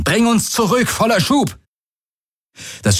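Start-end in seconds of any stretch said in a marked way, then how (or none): 0.0-1.6 very high-pitched urgent command; strained, high call to retreat; urgent shouted order. A narrator's reading. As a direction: cry out the order in an emergency. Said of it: "rief Galen".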